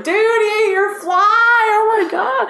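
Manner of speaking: very high, thrilled holler; excited scream. Retold or imitated. Guide screaming at an angler about a strike, excited. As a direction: shriek it like the fish just struck. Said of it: "and I'll scream".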